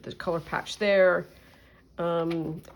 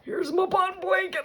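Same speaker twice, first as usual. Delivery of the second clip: excited; 11 semitones higher than usual. Real, not acted. Unveiling finished blanket.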